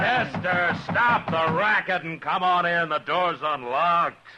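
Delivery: annoyed, just-woken shout to the door; calling back. Acted. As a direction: yell back to be heard outside.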